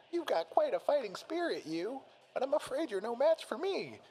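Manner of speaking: very high-pitched taunting bear voice; Teddy. A narrator's reading. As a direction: taunt the rival in a high cartoon voice.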